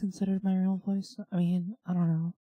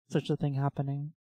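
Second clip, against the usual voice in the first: normal talking voice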